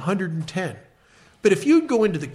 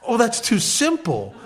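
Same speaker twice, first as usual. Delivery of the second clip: dismissive newcomer; 6 semitones higher than usual. Retold or imitated. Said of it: "he looked at me like".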